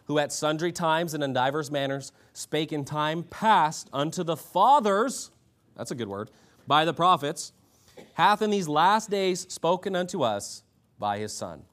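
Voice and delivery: preacher reading the Bible out to the room; emphatic scripture reading. A narrator's reading. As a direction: proclaim the verse with lifted pitch. Reads scripture aloud to congregation.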